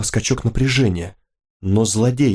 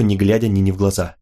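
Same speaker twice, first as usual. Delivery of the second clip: usual speaking voice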